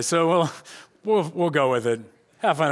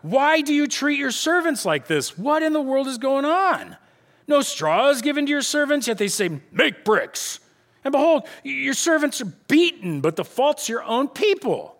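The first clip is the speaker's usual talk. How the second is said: high, aggrieved plea; crying out in protest; 8 semitones higher than usual. Acted. Foremen confronting Pharaoh over their treatment, crying out in protest. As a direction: plead indignantly, pitched well up. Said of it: "came and cried to Pharaoh".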